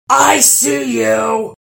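slow, loud, high roar; troll roar. Acted. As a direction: roar it at the fish. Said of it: "roared the troll".

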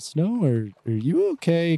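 mildly concerned. Acted. Companions check on a frantic Snow, mildly concerned. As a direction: ask carefully, with gentle concern. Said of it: "Both of them look at you mildly concerned".

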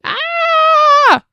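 sharp high startled scream; sudden scream. Real, not acted. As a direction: jump and let out a high yelp. Startled outburst mid-reading.

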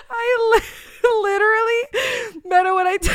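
high, loud laughing fit; trying to talk through a fit of laughter; laughing too hard to finish; helpless laughter. Real, not acted. Breaking up laughing.

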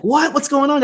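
high, startled "what?"; surprised friends. Retold or imitated. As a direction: jump up in surprise.

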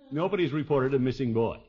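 ordinary talk

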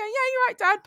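high, breezy cheeky answer; cheeky reply. Retold or imitated. Injured daughter answering her dad, breezy high voice. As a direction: toss the greeting back lightly. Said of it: "And I go".

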